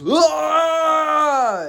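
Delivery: a loud, high victory shout; mock victory yell. Fighter yelling on the mic after winning, loud high shout. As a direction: let out a big yell into the mic. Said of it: "on the mic goes".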